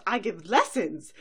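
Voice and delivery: loud indignant protest through laughter; haughty diva, laughing. Acted. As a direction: insist grandly while laughing. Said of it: "and Rarity goes".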